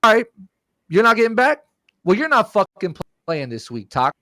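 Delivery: high-pitched scolding imitation; retold angry threat. Acts yelling teammate's threat.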